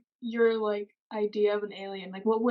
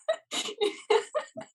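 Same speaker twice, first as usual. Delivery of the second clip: high eerie alien squeal; 14 semitones higher than usual. Pretend. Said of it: "Is that what you think an alien sounds like".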